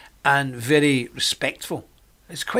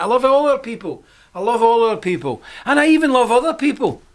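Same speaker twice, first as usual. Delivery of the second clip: louder and higher, building with feeling; rising declaration of love, much louder; loud praise; 9 semitones higher, 5 dB louder than usual. Real, not acted. To his viewers, a loud rising declaration of love.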